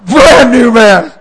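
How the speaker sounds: loud, high, drawn-out declaration; belted triumphant punchline; triumphant shout. Real, not acted. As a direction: proclaim it jubilantly, stretching the words. Triumphant punchline to laughing crowd.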